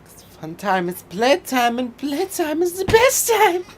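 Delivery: rising to a high, loud crazed squeal; evil German doctor. Acted. Doctor promising visitors playtime, rising crazed German voice. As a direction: climb into a manic German-accented shriek. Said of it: "like, the evil doctor thing from".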